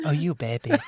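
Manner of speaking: light, soft laughing boxer voice; a hint of a gentle Mike Tyson impression. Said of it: "a really soft Mike Tyson".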